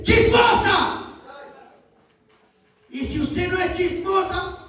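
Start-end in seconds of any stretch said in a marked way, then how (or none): 0.0-1.0 higher, slow cry of the insult; accusing call. Acted. Preacher voicing the slur, slow and high. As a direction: fling the word like an accusation. Calling out a gossip.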